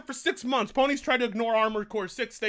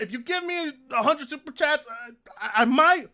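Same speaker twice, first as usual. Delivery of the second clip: usual speaking voice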